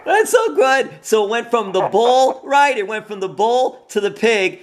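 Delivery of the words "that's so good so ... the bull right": laughing excited outburst. Real, not acted. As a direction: burst out thrilled at the name. Host excited by nickname story.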